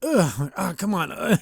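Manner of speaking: imitated out-of-shape grunting; straining effort. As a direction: grunt as if hauling yourself up, mock struggle. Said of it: "trying his best, but he's completely out of shape".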